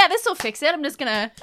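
high, loud mock confidence; dumb confident soldier. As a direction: play the clueless grunt. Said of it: "He's like".

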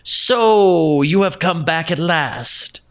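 lightly voiced old wife, high then slow; the badger playing a sweet old wife; old woman voice. A narrator's reading. Badger disguised as wife greets farmer, slow old woman voice.

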